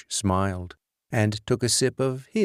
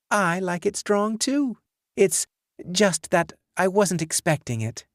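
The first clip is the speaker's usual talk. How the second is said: put-on lightly raised young woman's voice; higher, light female character voice; 10 semitones higher than usual. A narrator's reading. Kim voiced higher by narrator.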